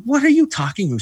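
high, bewildered what are you talking about; baffled. Retold or imitated. Baffled husband woken at night.

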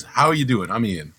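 usual speaking voice

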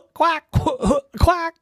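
high, loud quacks broken by acted coughs; coughing duck. A narrator's reading. Rescued duckling rides on Ducky's back, coughing and quacking. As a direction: splutter and quack like a smoky duckling. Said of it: "on his back was coughing".

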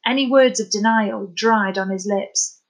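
usual speaking voice

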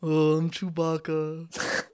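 deliberately poor Chewbacca impression, drawn out slowly; mock a friend's half-hearted Wookiee voice; slow put-on voice at ordinary pitch; Ellie. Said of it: "now here's Ellie's impression".

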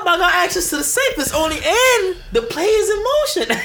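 high, loud, laughing brag; laughing boast. Acted. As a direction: crow it out through laughter. Gleeful bank robbing boast.

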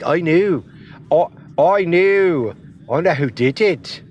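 a rough Scottish accent, words stretched slow; Scottish-accented Nikki Cross quote. Shown as a demonstration. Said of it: "That's my, that's my Scottish".

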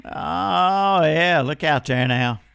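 ordinary talk

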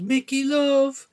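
high, slow, drawn-out greeting; high singing motherly call; mother on the phone. A narrator's reading. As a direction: stretch it out as a delighted-to-hear mam. Mother answering phone, voiced.

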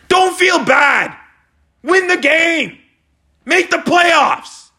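high, drawn-out yelled commands; exasperated shouted orders. Real, not acted. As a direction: yell each order at your team, stretched out. Yelling orders at the team.

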